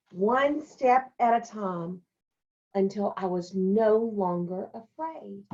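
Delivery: put-on brighter storytelling voice for the children; story reading. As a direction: read it like a picture book to kids. Teacher reads picture book aloud.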